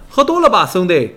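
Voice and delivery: high-pitched mocking imitation of a stranger butting in; imitated heckler. Friend imitates the stranger who cut in, high heckling voice. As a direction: throw it out high, half laughing. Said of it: "旁边竟然有一人就搭了一".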